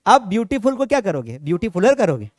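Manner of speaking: normal talking voice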